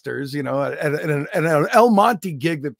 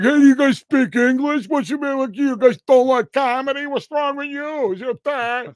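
high, goading mock taunt at a crowd; mocking heckle; 8 semitones higher, 5 dB louder than usual. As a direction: needle the audience in a put-on pitch. Reenacts heckling his silent audience.

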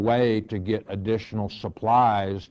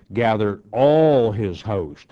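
all hit hard, louder and slow; stress on all; 6 dB louder than usual. Shown as a demonstration. Teacher stressing how big the army was, stressing all. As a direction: lean on the word all. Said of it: "I emphasize the word all".

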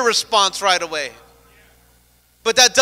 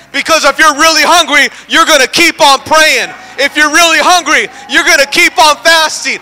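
loud, driving, rhythmic preaching; full-force preaching; 5 semitones higher, 8 dB louder than usual. Acted. Pastor urging the congregation to keep praying, preaching hard. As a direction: hammer the hunger point home.

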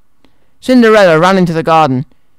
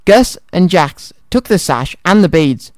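ordinary delivery, nothing marked

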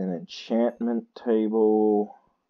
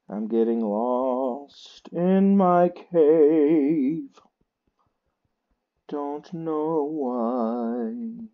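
very slow, stretched words; drawn-out lament; 4 semitones higher, much slower than usual. Real, not acted. Lost in his cave, slow and drawn out. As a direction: drag every word out wearily.

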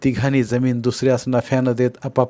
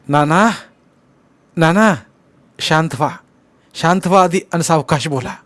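raised, urgent calming plea; concerned, higher-pitched calls to calm down; anxious soothing; 5 semitones higher, 4 dB louder than usual. A narrator's reading. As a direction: try to settle an agitated patient, voice pitched up. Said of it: "नानास धीर देत बोलले".

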